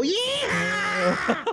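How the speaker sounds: very high drawn-out yell; mock scream. Storyteller acting out the dolphin ride, mock scream. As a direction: yell it out, pitch shooting way up. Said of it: "so I'm screaming".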